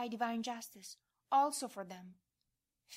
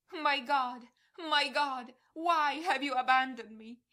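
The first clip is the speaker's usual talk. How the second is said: a loud, higher cry of abandonment; loud anguished cry; 3 semitones higher, 9 dB louder than usual. Acted. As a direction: cry it out to heaven in agony. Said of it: "you cry out loudly".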